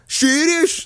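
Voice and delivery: very high-pitched mock Scouse; Scouse accent. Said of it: "That's the Scouse accent".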